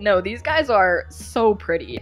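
ordinary delivery

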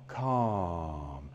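very low, soft, drawn-out word; low soothing calm. Shown as a demonstration. Trainer soothing his dog, very low and soft. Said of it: "I'm giving him the calm".

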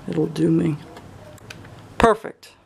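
ordinary talk, nothing marked

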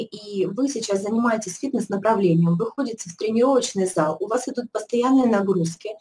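normal talking voice

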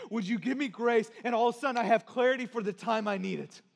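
forceful, driving preacher voice; impassioned testimony. Real, not acted. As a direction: pour out a desperate prayer before the congregation.

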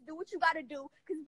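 ordinary talk

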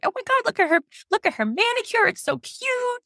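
put-on high, gushing girly voice of the nurses. Nurses gushing over her eyebrows in a high voice. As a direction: squeal the compliments like an excited nurse. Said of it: "We were like".

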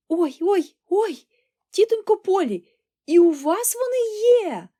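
high, loud, drawn-out cries of delight; delighted exclaiming. A narrator's reading. Thrilled girl exclaiming to her auntie, delighted cries. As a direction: exclaim it in sheer rapture, pitch soaring. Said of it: "захоплено вигукувала вона".

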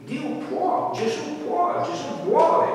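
high, fervent declaration; rising proclamation. Real, not acted. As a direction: proclaim it with growing fire. Preacher proclaims God's power.

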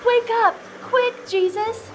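high, urgent cries to wake someone; panicked scream. Acted. Friends waking Jesus in the storm, panicked screaming. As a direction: call out high and frantic. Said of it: "they screamed".

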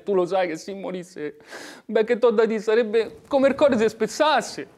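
pleading. Acted. Pleading with brother.

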